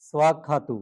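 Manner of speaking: স্বাক্ষাত said clearly with a swa sound, no final u; correct Pali pronunciation. Shown as a demonstration. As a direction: model স্বাক্ষাত syllable by syllable for students. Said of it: "উচ্চারণ". (স্বাক্ষাত স্পষ্ট উচ্চারণে)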